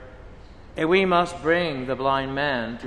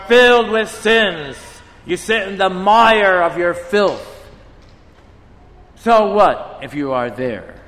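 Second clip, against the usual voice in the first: stern rebuke, raised and louder; 4 dB louder than usual. Retold or imitated. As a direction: thunder down at the proud sinner. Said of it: "And our Lord says".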